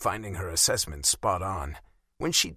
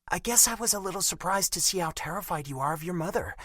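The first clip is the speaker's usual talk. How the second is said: put-on higher, hurt young woman's voice; a wounded girlfriend, raised in pitch; Bella's voice; 10 semitones higher, faster than usual. A narrator's reading.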